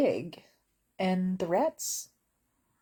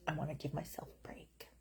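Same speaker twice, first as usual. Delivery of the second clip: unvoiced, much softer; whispered aside; 3 semitones lower, 14 dB quieter than usual. Real, not acted. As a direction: murmur it.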